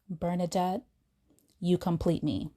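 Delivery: soft, imagined romantic confession; tender declaration. Retold or imitated. Man she dreamed of confessing love, tender declaration. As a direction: say it softly, as a fantasy lover would. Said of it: "a man would love me so much".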